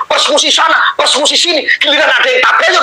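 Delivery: normal talking voice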